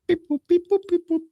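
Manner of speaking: high robotic beep boop; dialing beeps. Pretend. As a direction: imitate phone keypad tones. Imitates phone dialing tones.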